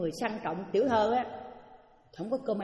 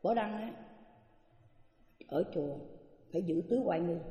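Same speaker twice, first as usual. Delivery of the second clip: soft, lower reproach; nun scolding Bảo Đăng, hushed; reproachful scolding; 3 semitones lower, 6 dB quieter than usual. Retold or imitated. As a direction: lecture her quietly about manners. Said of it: "bà mới trách".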